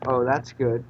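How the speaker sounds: usual speaking voice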